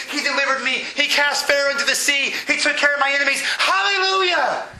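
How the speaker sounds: high, building list of praises, topped with a cry; exultant psalmist. Retold or imitated. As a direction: pile up praises toward a high shout. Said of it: "sometimes it's like".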